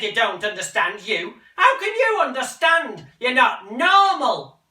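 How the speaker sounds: high, loud and slow; furious mother. Acted. Mother lashing out at son, high and furious. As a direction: fling it at him.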